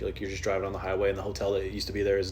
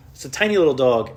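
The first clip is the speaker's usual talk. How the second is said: ordinary delivery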